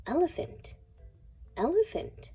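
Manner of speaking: ordinary delivery, nothing marked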